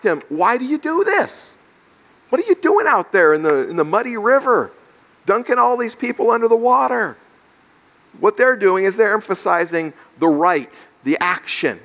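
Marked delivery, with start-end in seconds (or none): 0.3-7.2 high-pitched, incredulous challenge; incredulous questioning. Acted. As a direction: act out a baffled official demanding answers. Acting the delegation questioning John.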